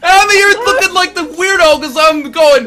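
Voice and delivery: high, loud, indignant shouting; worked-up comic outrage; exasperated rant. Real, not acted. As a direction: rail against everyone's craziness. Heated comic rant about food.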